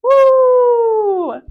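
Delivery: very loud high howl; joyful howl. A narrator's reading. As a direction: howl together with joy. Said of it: "something that surprised them all".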